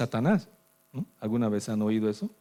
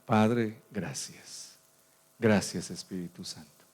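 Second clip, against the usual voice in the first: prayer. Acted. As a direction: pray gently over the music. Leads prayer over music.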